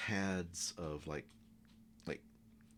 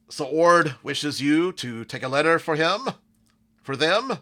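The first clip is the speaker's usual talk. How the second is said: high, booming call through a door; loud call; 9 semitones higher, 16 dB louder than usual. Acted. Minotaur calling into an office after knocking, loud. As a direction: shout it out to be heard in the office. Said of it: "knocks on the door to Jimishaga's office loudly".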